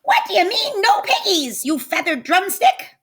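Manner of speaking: high-pitched screeching witch. Acted. Witch screeching at the chicken, high and shrill. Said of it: "Gritch screeched".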